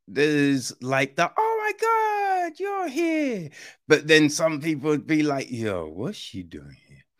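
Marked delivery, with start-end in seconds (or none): 1.4-3.5 gushing welcome. Retold or imitated. Friends greeting arrival at party. 5.5-7.1 suspicious mutter. Retold or imitated. Others suspicious of newcomer.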